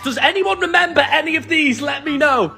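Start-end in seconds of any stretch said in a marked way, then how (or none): none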